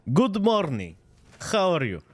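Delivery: hammed-up would-be French accent; mock French accent. Streamer showing chat his new French accent, hammed-up. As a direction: put on a silly French accent. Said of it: "It's my new French accent".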